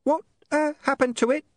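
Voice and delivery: high, nervous little imp voice; anxious imp asking about its predecessor; apprehensive. A narrator's reading. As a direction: squeak it out warily. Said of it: "very apprehensively".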